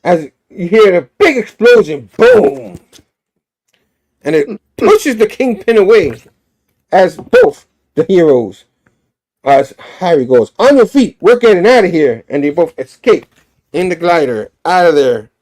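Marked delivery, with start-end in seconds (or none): 2.2-2.8 very high, loud boom; imitated explosion. 10.6-12.2 high, loud hero's order; rally a partner to flee, voice raised; urgent rallying shout. Acted. Harry urges escape from fight.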